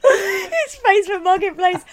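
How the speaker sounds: high, slow, wheezing with laughter; laughing. Pretend. Co-host repeating the punchline, high and laughing. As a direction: stretch it out while cracking up.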